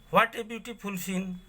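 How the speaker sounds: high, sudden what of amazement; surprised. Shown as a demonstration. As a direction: gasp at a lovely view. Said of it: "surprised suddenly".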